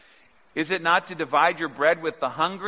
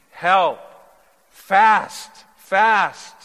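louder, rising on each word, pausing between them; climactic cry; 6 semitones higher, 9 dB louder, much slower than usual. Acted. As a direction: hammer home each single word. Climactic call to fast.

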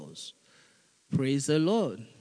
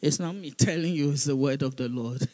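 ordinary talk, nothing marked

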